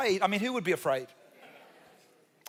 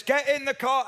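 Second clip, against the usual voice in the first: higher, louder dad command; firm dad order; 6 semitones higher, 3 dB louder than usual. Retold or imitated. Dad ordering the kids into the car, firm and louder. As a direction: bark it like a dad who means it.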